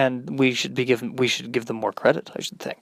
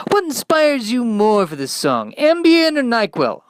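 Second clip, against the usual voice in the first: radio DJ; 8 semitones higher than usual. Acted. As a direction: pump up the show like a cheesy DJ.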